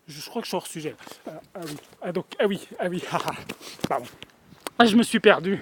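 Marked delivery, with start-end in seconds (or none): none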